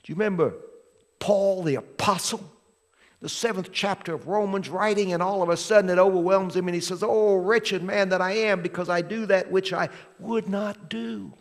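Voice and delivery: loud, fast fervent preaching; preaching at full force. Acted. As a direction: preach it to the back row.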